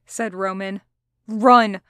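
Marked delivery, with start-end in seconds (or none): 1.3-1.8 loud, sudden order to flee; an alarmed, forceful command; urgent shout. A narrator's reading. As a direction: call it out hard and urgent. Urgent command as hunters approach.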